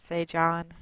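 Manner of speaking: ordinary delivery, nothing marked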